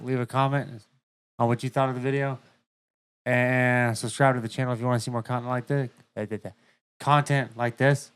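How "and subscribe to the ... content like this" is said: normal talking voice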